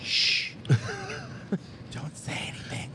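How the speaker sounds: breathy threatening whisper; dark whisper behind you. Acted. Repeats the creepy hush.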